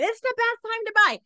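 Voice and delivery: high, loud salesman pitch; slick used car salesman. Pretend. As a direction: sell it like a used car dealer. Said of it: "I sound like a used car salesman when I say it".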